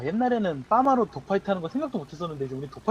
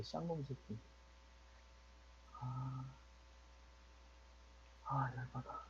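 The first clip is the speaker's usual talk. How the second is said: muttered curses, much softer; cursing under breath; 5 semitones lower, 22 dB quieter, slower than usual. Real, not acted. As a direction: swear quietly to yourself. Cursing after being killed.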